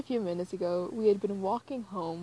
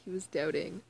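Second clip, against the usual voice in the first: usual speaking voice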